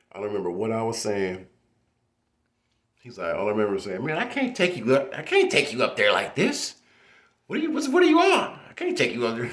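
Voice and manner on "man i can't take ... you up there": high, loud alarmed voice; shocked disbelief. Retold or imitated. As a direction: squeak out the shock of the driver. Driver shocked at his state.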